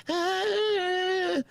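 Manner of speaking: high squealed ah; imitating a movie heroine's cry; mock scream. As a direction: let out a high frightened squeak. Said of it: "she's like".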